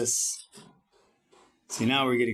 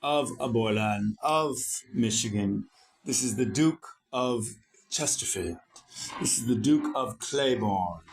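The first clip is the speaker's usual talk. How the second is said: slow, lightly formal royal herald voice; royal announcer; slower than usual. Retold or imitated. Mocking how royalty is introduced, slow and lightly stately. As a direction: announce the titles slowly like a court herald, only lightly put on. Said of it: "that's how they say it in royalty".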